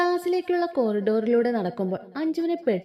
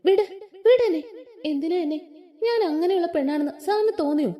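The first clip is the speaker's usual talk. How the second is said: frightened protest; 7 semitones higher than usual. A narrator's reading. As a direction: push him off in alarm. Girl struggles against his grip.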